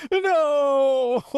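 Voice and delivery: high, loud drawn-out cry; hammed-up cry of doom; mock wail. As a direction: wail it like a cartoon victim. Skit victim's drawn-out cry.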